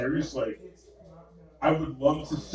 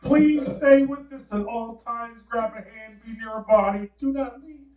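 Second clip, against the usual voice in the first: stern scolding parent; 11 semitones higher, 5 dB louder than usual. Retold or imitated. Father scolding his son after he wandered off, in a high hammed-up scold. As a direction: lay down the law to a wandering kid, hammed up. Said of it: "I said".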